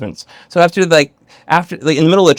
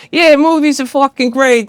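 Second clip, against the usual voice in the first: exaggerated mock Boston accent, high and slow; drawn-out Boston accent impression; Nicole Kidman; 11 semitones higher, slower than usual. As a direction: put on a bad, high-pitched Boston accent. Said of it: "with her Boston accent".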